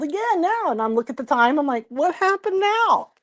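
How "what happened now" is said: high, drawn-out mock exasperation; exasperated question. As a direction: retell a weary parent's question, rising high and slow. Exasperated at another late-night call.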